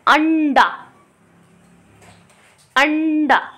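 अंडा with a short अ; short a demo. Shown as a demonstration. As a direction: say अंडा plainly for the class. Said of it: "ये exercise आपको करनी है". (अंडा में छोटा अ)

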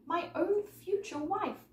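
high, drawn-out exclamation; heartfelt cry. A narrator's reading. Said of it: "cried Harry".

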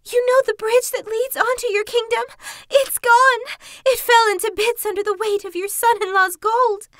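high, loud, breathless wailing; panting. Acted. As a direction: pant out the disaster. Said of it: "panted the fox".

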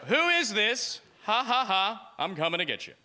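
teasing singsong, starting high then dropping; playful mock menace. Joking text to the girl, playful mock menace. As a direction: play a goofy creepy prankster. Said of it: "I text her, I go".

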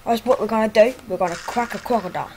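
hammed-up Aussie croc-hunter voice; a light mock Australian accent, rolling crocodile; Stephen Irwin impression. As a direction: play the excited Australian wildlife host. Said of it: "this is what he really sounds like".

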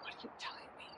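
the question whispered, barely audible. Shown as a demonstration. Guide asks the wind in the trees, whispering. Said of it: "whispering, ask".

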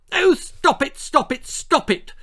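put-on high, loud squeal of a spoilt girl; shrieking at the sweeper to stop. A narrator's reading. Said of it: "she squealed".

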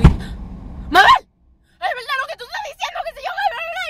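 high, frantic panicked voice; panicked friend. Retold or imitated. Gustavo suddenly agreeing with Mabel, high panicked squeal. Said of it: "Gustavo me hace".

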